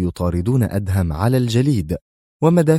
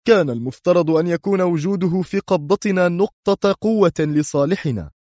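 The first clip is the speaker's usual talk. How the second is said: high, sharp defensive reply; 9 semitones higher than usual. Acted. Mr X answers Sonia sharply, high. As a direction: snap back in his own defence. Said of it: "قال في حدة".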